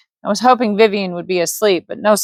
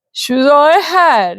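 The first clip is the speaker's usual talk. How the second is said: choking up; 8 semitones higher, 3 dB louder than usual. Acted. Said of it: "choking up".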